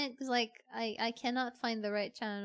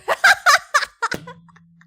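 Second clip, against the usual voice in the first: big high laugh burst; loud shrieking laugh; 14 semitones higher, 18 dB louder than usual. Real, not acted. Chat says she feels represented.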